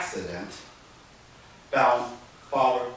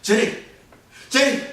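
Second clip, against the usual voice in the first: higher, loud moaning regret; a mild mock lament; grieving father; 13 semitones higher, 5 dB louder than usual. Master grieves at bedside.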